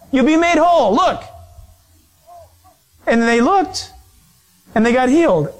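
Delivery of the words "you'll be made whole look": calling out. Acted. Acts out Moses urging the people.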